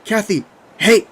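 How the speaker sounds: loud, high, drawn-out call; snapping her out of panic. A narrator's reading. Narrator to panicking Kathy, snapping at her. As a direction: shout to break through her panic. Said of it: "I tried to snap at her".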